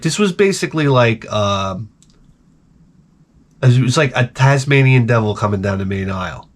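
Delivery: normal talking voice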